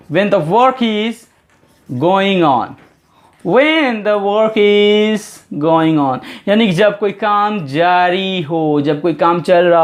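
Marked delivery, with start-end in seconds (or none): 0.1-2.7 "when the work is" drawn out word by word; teacher dictating the definition to students, very slow; slow dictation. Shown as a demonstration. Dictate each word slowly for copying. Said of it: "तो आप यही बताइएगा". 3.5-6.2 "when the work is" drawn out word by word; teacher dictating the definition to students, very slow; slow dictation. Shown as a demonstration. Dictate each word slowly for copying. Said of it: "तो आप यही बताइएगा".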